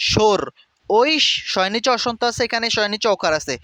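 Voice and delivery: usual speaking voice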